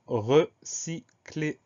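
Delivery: "recycler" slowly split as re-cy-cler; syllable by syllable. Shown as a demonstration. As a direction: dictate "recycler" in separate syllables. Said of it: "Première syllabe re".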